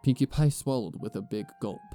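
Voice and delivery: ordinary delivery, nothing marked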